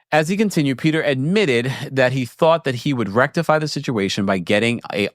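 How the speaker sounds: ordinary delivery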